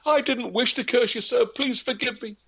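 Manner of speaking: very high, desperate pleading; girl begging the peddler for mercy, very high; begging forgiveness. A narrator's reading. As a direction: plead on your knees, voice up high. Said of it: "begged and begged forgiveness".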